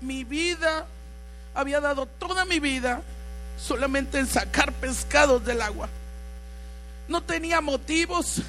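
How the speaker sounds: loud, fervent sermon voice over music; preaching at full force. Acted. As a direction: preach it out with passion. Preaching Peter's story. (predicando con fuerza y fervor)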